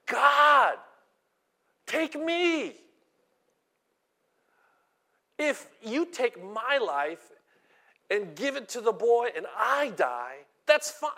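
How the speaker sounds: crying out high and loud to God; emotional cry. Acted. Elijah begging God over the dead boy, crying out emotionally. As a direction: plead out loud, offering your own life. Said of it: "He's crying out emotionally".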